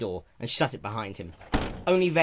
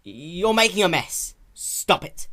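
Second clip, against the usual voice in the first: higher, louder, slow, scolding with a tremor; firm but trembling; 9 semitones higher, 8 dB louder, slower than usual. A narrator's reading. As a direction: try to sound stern while scared. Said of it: "struggling to quell the tremor in his voice".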